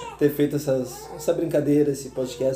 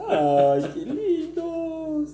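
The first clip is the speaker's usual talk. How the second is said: gushing; 13 semitones higher, much slower than usual. Pretend. Mock-touched response to I love you.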